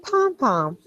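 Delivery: a playful Jersey "Pom pom"; New Jersey accent. Pretend. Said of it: "say the word pom-pom".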